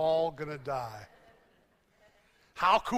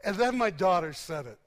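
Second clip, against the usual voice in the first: ordinary delivery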